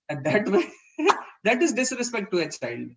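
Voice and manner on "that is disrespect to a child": normal talking voice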